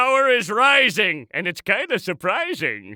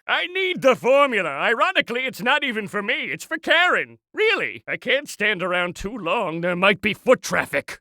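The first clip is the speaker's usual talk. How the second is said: usual speaking voice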